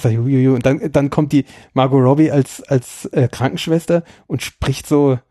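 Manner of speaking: ordinary delivery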